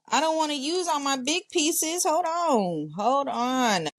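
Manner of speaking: high, sing-out 'hold on'; high-pitched fussing. Real, not acted. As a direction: fuss to yourself in a high voice. Fussing to herself over big pieces.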